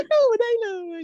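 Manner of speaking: very high, stretched sing-song เข้ามาได้เลย; high drawn-out tease. Shown as a demonstration. Host showing how the actor said the line, very high and coy. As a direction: lift เข้ามาได้เลย way up and draw it out coyly. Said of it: "ทำเสียงแบบนี้ด้วยนะ".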